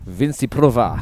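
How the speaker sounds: rolled Italian r on Vinciprova; gentle Italian name flourish; Italian accent. Retold or imitated. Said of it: "you've even got the roll of the tongue in there".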